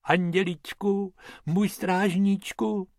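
pious high-pitched poacher's prayer; praying. A narrator's reading. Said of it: "Pytlák klečel před lvem a modlil se".

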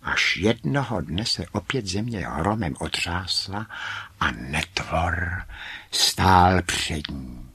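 usual speaking voice

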